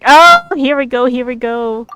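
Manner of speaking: loud, eager "here we go"; excited. Real, not acted. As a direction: hype yourself up for the reveal. Streamer anticipates a big answer.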